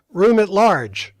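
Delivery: slow, loud call across the office; loud, drawn-out shout to the room. Retold or imitated. Checker calls out to room.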